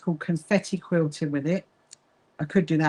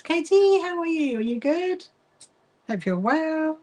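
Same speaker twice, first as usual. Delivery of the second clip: bright greeting; 9 semitones higher than usual. Real, not acted. Greeting a viewer in chat.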